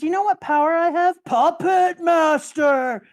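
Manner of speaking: slow, high, shouted villain boast. Retold or imitated. Recapping a villain to a friend, booming gloating shout. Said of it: "Doflamingo says".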